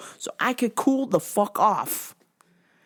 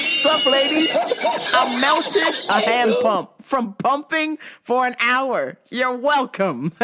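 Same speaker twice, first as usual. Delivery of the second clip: big, higher, drawn-out mock boast; mock bragging; 4 semitones higher, 4 dB louder, slower than usual. Pumper boasting to the ladies about the melted pump, mock swagger. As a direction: show off to the ladies, laughing underneath.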